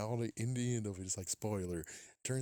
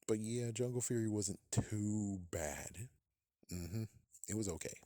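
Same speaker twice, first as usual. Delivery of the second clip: ordinary delivery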